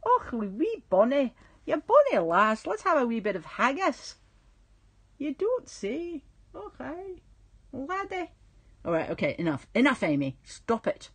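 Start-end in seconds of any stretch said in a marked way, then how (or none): 0.0-4.2 higher, hammed-up Scottish accent; mock Scottish accent. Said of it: "I go into these weird accents". 5.2-8.3 high, drawn-out Scottish lilt; mock Scottish accent. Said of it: "I go into these weird accents".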